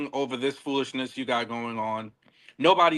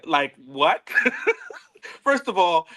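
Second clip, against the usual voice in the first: high laugh spills into the words; laugh, then push on; 4 semitones higher than usual. Real, not acted. Cracking up about the apology, laughing through it.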